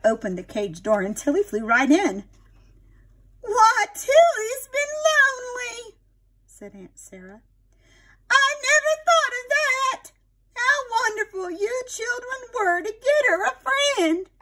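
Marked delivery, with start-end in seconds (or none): 3.4-6.0 put-on high, slow, moved old lady; touched aunt. Aunt Sarah realising her bird was lonely, high slow and touched. 8.3-14.3 put-on high, delighted old lady; grateful aunt. Aunt Sarah thanking the children, high and delighted.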